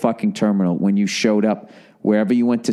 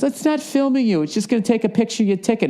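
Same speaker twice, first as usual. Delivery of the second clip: high, quick mock waitress with a laugh; the waitress brushes off his worry in a high, hurried, laughing voice; waitress explaining; 12 semitones higher than usual. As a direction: toss it off high and fast like a chirpy server. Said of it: "She goes".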